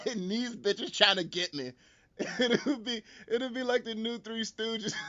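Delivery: slow words through real laughter; laughing while talking. Real, not acted. Pitching the movie while laughing, slow. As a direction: still cracking up as he talks.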